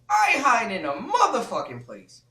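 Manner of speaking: loud, emphatic retold protest; indignant refusal. Man refusing to hide in her house, indignant. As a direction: raise it hard in mock outrage.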